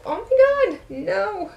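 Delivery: high squealing burst of surprise; shrieking oh my god no; shocked shriek. Real, not acted. Sudden shock over the palette.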